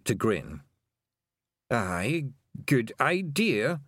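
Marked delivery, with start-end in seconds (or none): 1.7-3.8 slow, dawning agreement; slow Scottish assent; slowly. A narrator's reading. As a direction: draw it out as it sinks in. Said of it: "he said slowly".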